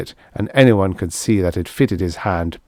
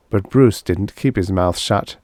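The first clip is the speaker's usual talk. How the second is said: ordinary delivery, nothing marked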